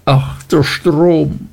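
slow, high-pitched impassioned wail; passionate cry. A narrator's reading. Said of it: "rief sie leidenschaftlich".

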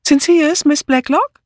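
high-pitched call. A narrator's reading. Woman in the hall calling up towards a creaking floorboard. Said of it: "wandte und rief".

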